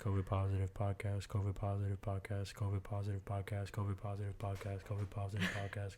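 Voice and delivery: rapid soft tongue-twister repeats; fast tongue twister. Shown as a demonstration. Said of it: "Go ahead, whisper it".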